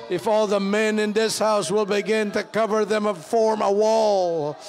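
rising, loud preaching prayer; fervent prayer. Real, not acted. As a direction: pray it out with full force. Prayer building to full force.

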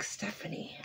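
breathy whisper of a name to herself. Real, not acted. Trying to remember who sent it, whispering the name. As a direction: whisper it while racking your memory.

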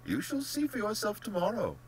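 lilting, genial reassurance; polished, pleasant general, lifting in pitch; most pleasant manner. A narrator's reading. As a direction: chide and reassure with airy pleasantness. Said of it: "said in his most pleasant manner".

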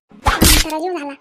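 furious shout. Acted. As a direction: yell म्हातारा जीव झाला at the old man. Woman scolds old man loudly. (बसमधल्या म्हाताऱ्यावर संतापून ओरडणारी बाई)

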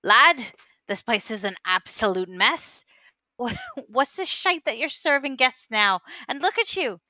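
loud, high scolding rant at a lad; scolding dwarf barkeep. Acted. Dwarf barkeep loudly scolding the messy young bartender. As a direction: berate the young bartender for the mess. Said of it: "he's gonna be like".

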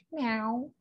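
high, soft kitty meow; cat's meow. A narrator's reading. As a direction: mew softly like a cat. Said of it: "says the cat".

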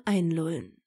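ordinary delivery, nothing marked

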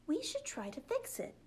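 put-on high little girl voice; lightly voiced young girl; cheerful Olive. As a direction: chirp it like a small girl.

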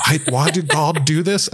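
a Cuomo impression that slips into a British accent; mock British accent, high; imitating Cuomo but it comes out British; accidental British. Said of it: "that was my accent or my impression, and it turned out to be British".

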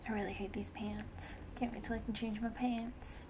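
whispered aside; breathy whispered complaint; whispering. Real, not acted. Muttering to herself while playing.

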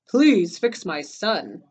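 slow, firm storybook demand; measured, insistent request; demanding father. Acted. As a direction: press each word as an acted father. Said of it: "demanded Crespin's father".

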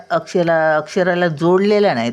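ordinary talk

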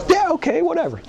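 high, mock-casual brush-off; dismissive yeah whatever. Shrugging off Mister Perfect's odd request, high and dismissive. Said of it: "And I was like".

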